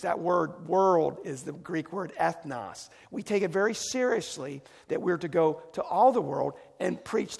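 preacher rousing the church to missions, loud and urgent; fervent preaching. Acted. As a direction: press the mission on the congregation. Preaching at full force.

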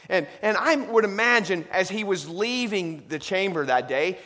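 loud, climbing sermon voice; rising joyful preaching. Acted. Preaching rises to full force.

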